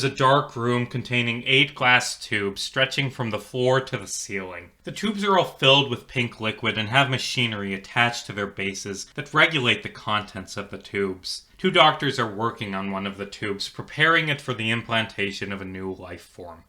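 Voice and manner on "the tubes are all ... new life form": usual speaking voice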